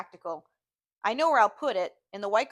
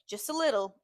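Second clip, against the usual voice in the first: ordinary delivery, nothing marked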